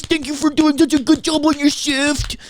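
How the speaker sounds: mocking clappers. Mocking neighbours thanking medical workers, high and syrupy. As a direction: gush like a smug balcony clapper.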